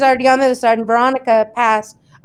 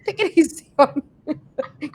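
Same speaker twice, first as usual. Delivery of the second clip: laughing; 3 semitones higher than usual. Retold or imitated. Repeated while laughing.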